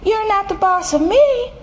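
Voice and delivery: high-pitched cheeky retort; defiant kitten. A narrator's reading. Kitten answering his mama's order, defiant. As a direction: talk back in a high little-kid voice.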